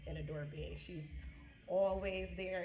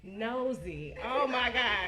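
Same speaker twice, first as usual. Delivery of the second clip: loud laughing oh my God; 4 semitones higher, 8 dB louder, much slower than usual. Real, not acted. Teasing her aunt at the party, laughing loudly. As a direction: burst out laughing.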